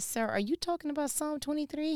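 high teasing surprise; mock innocent question. Quoting herself to Holy Spirit.

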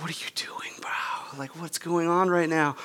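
hushed, disbelieving retold whisper; alarmed whisper. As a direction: whisper it urgently to a reckless friend. Retold alarmed aside to his friend.

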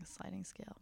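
unvoiced soft whisper. Real, not acted. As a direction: drop to a whisper. Lowers voice about money.

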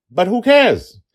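high, loud carefree toss-off; breezy shrug. Real, not acted. Author shrugging off his bad marketing, high and loud.

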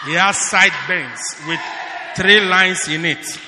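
hammed-up shouted vision; mock prophetic shouting. Pastor imitating a shouting prophet to his church. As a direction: bellow like an over-the-top prophet. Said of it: "Lord, you say".

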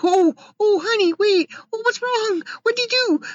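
imitating a sister fretting over her on the phone; sister's worried voice. Sister answering a crying call, high and anxious. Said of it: "And she's like".

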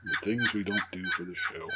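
hoarse, faded voice; raspy whisper. Real, not acted. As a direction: whisper it through a sore throat. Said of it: "I have no voice now".

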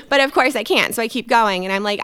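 usual speaking voice

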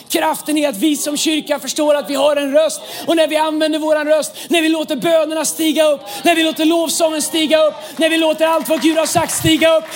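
loud, high, building to a shouted climax; full-force preaching. Real, not acted. Preacher declaring the power of the church's voice, impassioned shouting. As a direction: drive each phrase harder like a revival preacher.

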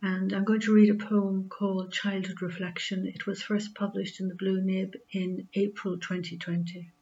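her own Carlow Irish accent. Real, not acted. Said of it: "I'm from Carlow in Ireland".

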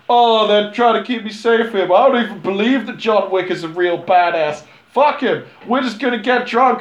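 high, loud bratty partygoer; mocking drunk brat. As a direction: sneer it like a spoiled drunk. Mocking Theon Greyjoy in hot tub.

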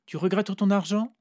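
high, loud questioning; Vera asking. A narrator's reading. Vera questions the hesitant narrator, high and loud. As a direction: needle him about money.

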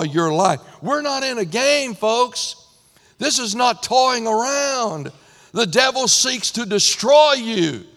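loud, rising preacher voice building urgency; preaching. Acted. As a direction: press the warning on the congregation. Preaching at full force.

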